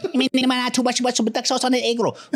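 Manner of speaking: high-pitched gibberish chant in a put-on Japanese accent; mock chant. Comedian showing his accents bit, high gibberish chant. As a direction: rattle off fast nonsense syllables, way up high. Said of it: "And I chant".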